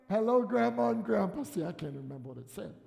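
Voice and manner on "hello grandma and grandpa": higher, louder announcing; bright announcement. Retold or imitated. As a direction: read the shirt proudly. Quoting baby shirt, acting Rachel.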